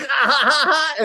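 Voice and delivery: high, loud put-on cackle of glee; mock giggle. Podcaster mimics Tracy Morgan laughing at a whisper, high giggle. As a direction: laugh like a giddy schoolboy. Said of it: "Tracy's like".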